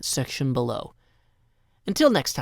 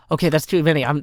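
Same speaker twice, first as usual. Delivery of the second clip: ordinary talk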